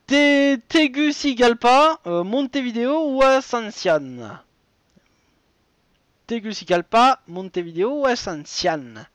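animateur de quiz qui énonce la question et les choix; présente chaque réponse comme un jeu télé; lecture de quiz. Acted. Host reads quiz question. (voix d'animateur de jeu, un peu plus fort)

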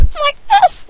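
very high falsetto squeak; weird high voice. Shown as a demonstration. Gamer shows off cold-affected voice, squeaking high. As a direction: flip the voice right up high. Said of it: "making my voice do weird things".

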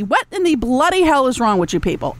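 ordinary delivery

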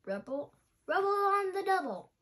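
high, loud cheerful catchphrase; catchphrase exclaim. Acted. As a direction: shout the team motto. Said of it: "the pup exclaimed".

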